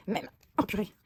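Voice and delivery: strained, quiet words while twisting a cap; pushing words out through the effort; straining to open a tube. Real, not acted. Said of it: "je n'arrive pas à l'ouvrir".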